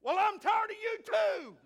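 high, full-volume comic yell; hard-of-hearing shout. Retold or imitated. Hard-of-hearing husband answering his wife, loud comic shout. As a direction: bellow it like someone who can't hear himself.

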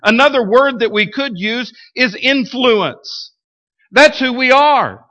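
rising, emphatic pulpit preaching. Acted. Preaching builds intensity.

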